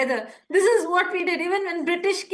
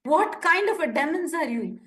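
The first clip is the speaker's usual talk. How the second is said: ordinary talk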